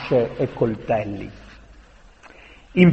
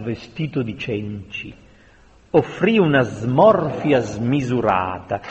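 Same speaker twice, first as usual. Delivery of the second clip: ordinary delivery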